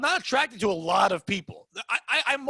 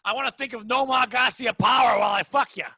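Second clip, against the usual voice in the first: hammed-up Boston chick; 3 semitones higher, 4 dB louder than usual. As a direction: yell it like a drunk Boston girl. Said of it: "like a Boston chick".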